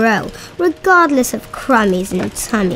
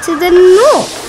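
proud; 8 semitones higher, 7 dB louder than usual. Acted. Once-ler boasting of shipping everywhere.

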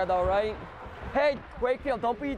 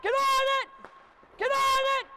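shouting; 10 semitones higher, slower than usual. Real, not acted. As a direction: bellow it across the rink. Coach yelling at players to pressure.

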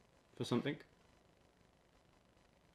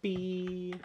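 bee voice; 8 semitones higher, 4 dB louder than usual. Pretend. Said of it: "bee impression".

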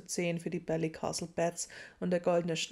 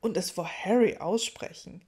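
louder indignant add-on; heated outrage; 7 dB louder than usual. A narrator's reading. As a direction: keep the outrage going. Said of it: "ereiferte sich Ron".

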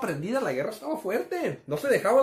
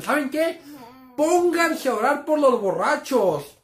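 higher, louder, slow stern command; stern mom; 6 semitones higher, 5 dB louder, slower than usual. Retold or imitated. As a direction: lay down the law like an angry mother. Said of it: "Mi mamá nos dijo".

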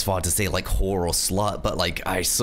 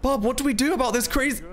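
high-pitched flustered question; alarmed appeal; 14 semitones higher than usual. Real, not acted. Asking Bob what to do after the crash, high and alarmed.

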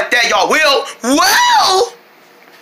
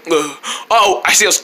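ordinary talk, nothing marked

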